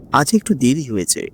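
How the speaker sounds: ordinary delivery, nothing marked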